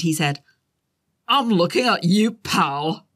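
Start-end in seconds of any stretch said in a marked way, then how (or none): 1.3-3.1 lightly done Scottish tough guy, raised pitch; a hint of Scottish menace, pointing at him; boy doing a Scottish hard man; tough guy Scottish accent. A narrator's reading. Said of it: "in his best tough guy Scottish accent".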